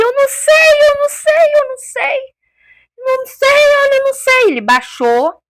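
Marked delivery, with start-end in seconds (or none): 0.0-4.4 high, louder, frantic repeating; panicked denial. Retold or imitated. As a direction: voice the stricken father crying out he doesn't know. Husband, hands on head, panicked. (pai em pânico, voz aguda e alta)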